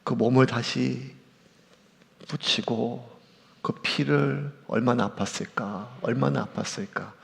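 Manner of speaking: soft, low and aching, almost under the breath; hushed grief. Real, not acted. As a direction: murmur it as if the pain were your own. Imagining the pain of Jesus' body.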